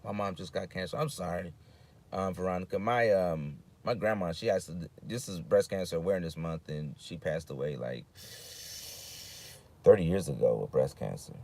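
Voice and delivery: low, soft sympathetic tone; somber. Real, not acted. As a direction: quietly share grief with the viewer. Sharing cancer loss, sympathy.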